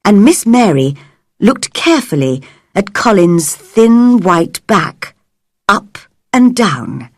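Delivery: plain reading voice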